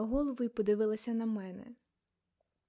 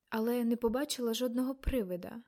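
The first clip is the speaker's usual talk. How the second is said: plain reading voice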